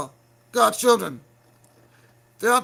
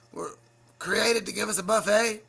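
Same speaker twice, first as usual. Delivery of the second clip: usual speaking voice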